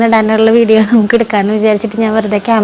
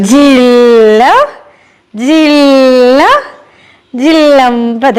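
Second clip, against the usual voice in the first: playful drawn-out word; much slower than usual. Real, not acted. Mother amusing her small child, playful drawn-out word. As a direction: stretch each word out very slowly.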